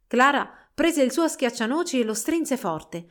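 plain reading voice

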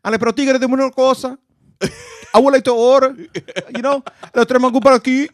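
put-on loud, high Dominican accent. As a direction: order food in a Dominican accent. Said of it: "I wanna hear the Dominican accent".

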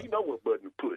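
ordinary delivery

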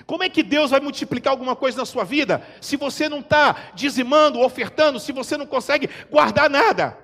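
loud high rhetorical questions; rising preaching. Acted. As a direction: hammer the congregation with questions.

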